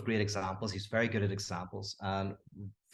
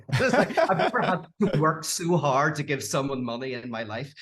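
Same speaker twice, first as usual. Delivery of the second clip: breaking into laughter, pitch jumping up; laughing; 3 semitones higher, 5 dB louder than usual. Real, not acted. To the host about paying Todd, laughing. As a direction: laugh through the line in real amusement.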